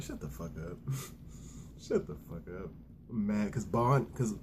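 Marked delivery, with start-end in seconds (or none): 0.0-2.7 low, soft mock snap; quiet grumbled shut-up; muttered retort. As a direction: mutter it under your breath with a chuckle. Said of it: "I'm like".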